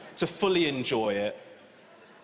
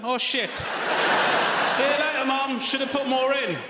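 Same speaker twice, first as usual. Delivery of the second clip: higher, loud mock-shouting mum; mum on a boat; 10 semitones higher, 5 dB louder, slower than usual. Mum being deported shouting back to her son, high comic shout. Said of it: "It's just her on a boat going".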